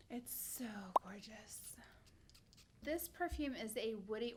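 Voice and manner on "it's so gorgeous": hushed, breathy whisper of awe; admiring whisper. Real, not acted. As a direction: breathe it out like you can't believe it. Admiring the perfume.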